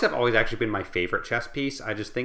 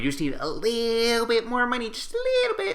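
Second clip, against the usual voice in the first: much higher, squeaky, wheedling plea; mock Kickstarter plea; 12 semitones higher than usual. Kickstarter creator begging backers, high mock-pleading squeak. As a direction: beg for just a little more money in a mocking squeak.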